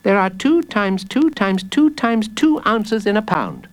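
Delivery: put-on high little boy reciting; high, eager kid giving the answer; child's voice. A narrator's reading. Jacob solves the villain's riddle, high child voice.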